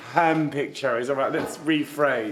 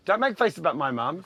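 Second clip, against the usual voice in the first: ordinary delivery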